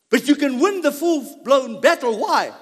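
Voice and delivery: rally the congregation; preaching at full force. Acted. Preacher urging the congregation, loud and high.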